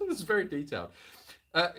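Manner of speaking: usual speaking voice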